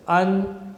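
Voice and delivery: understand split as un, der, stand with pauses; syllable by syllable. Shown as a demonstration. Teacher shows the three syllables, understand broken into un, der, stand. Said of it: "These are the three syllables".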